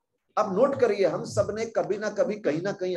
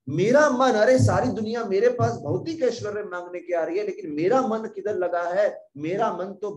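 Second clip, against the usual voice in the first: Shiva's voice; 5 semitones higher, 5 dB louder than usual. Acted. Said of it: "शिव जी बोलते हैं".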